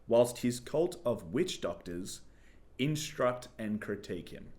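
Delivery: slow, phrase-by-phrase proclamation; deliberate repeat. Shown as a demonstration. Host repeats the announcement for co-host, slow and deliberate. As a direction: spell out the news slowly, pausing after phrases. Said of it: "I just wanna hear it again".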